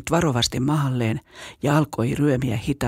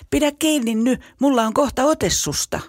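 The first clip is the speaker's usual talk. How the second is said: put-on urgent boy; 9 semitones higher than usual. A narrator's reading. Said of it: "pääriitapukari sanoi".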